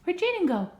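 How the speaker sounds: put-on a bright peekaboo for a toddler, pitched high; high excited peekaboo. Mom playing peekaboo with her son, high excited voice.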